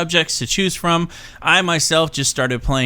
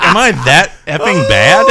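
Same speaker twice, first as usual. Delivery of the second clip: incredulous self; 5 semitones higher, 7 dB louder than usual. Retold or imitated. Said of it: "And I was like".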